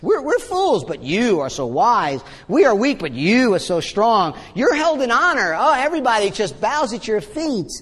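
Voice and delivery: high swoops of mock admiration; exaggerated sing-song irony, stretching the praise; sarcastic. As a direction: pile on the pretend flattery. Acting out Paul's sarcastic contrast.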